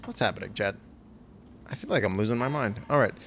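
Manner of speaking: usual speaking voice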